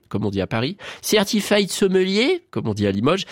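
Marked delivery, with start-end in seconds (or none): 1.0-2.5 slow, higher mock English accent, rising; put-on English accent. Shown as a demonstration. Presenter listing exam levels, slow put-on English accent. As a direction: stretch it out in a rough English accent.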